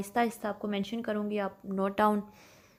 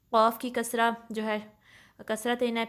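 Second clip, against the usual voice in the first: normal talking voice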